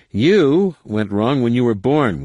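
joking jibe. A narrator's reading. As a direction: draw out the insult for a laugh. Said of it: "one of the warriors joked".

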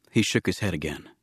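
plain reading voice

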